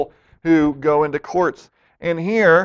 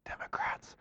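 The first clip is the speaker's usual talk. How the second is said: hushed whisper; 21 dB quieter than usual. Real, not acted. Preacher whispering a risky word to the congregation, cheeky hush. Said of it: "can I say it?".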